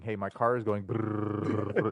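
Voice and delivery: slow, drawn-out car noise; clueless customer. Retold or imitated. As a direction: drag out the brrrr like an engine. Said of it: "going to a random stranger and saying".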